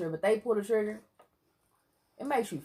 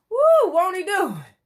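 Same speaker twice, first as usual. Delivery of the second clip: high, loud church-style whoop; jubilant, high-pitched holler of thanks; exultant praise shout; 13 semitones higher, 13 dB louder, slower than usual. Real, not acted. Praise exclamation after crediting God.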